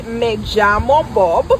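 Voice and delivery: slow, measured "Me llamo Bob"; teacher's correction. Shown as a demonstration. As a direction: model "Me llamo Bob" slowly for a learner. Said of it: "You say".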